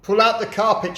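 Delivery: high, loud command; shouted order. A narrator's reading. Mugglewump orders monkeys and birds, high loud shout. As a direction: bark the order at the animals. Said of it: "shouted Mugglewump".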